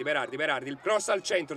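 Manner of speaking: usual speaking voice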